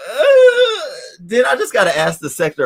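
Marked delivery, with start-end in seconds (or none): 0.1-1.2 high squeal. Real, not acted. Exclaims before question.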